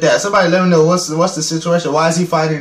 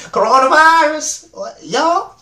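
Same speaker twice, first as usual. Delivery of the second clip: [name] impression retake; 9 semitones higher, slower than usual. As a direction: redo the [name] yell, still high but steadier. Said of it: "let me do it again".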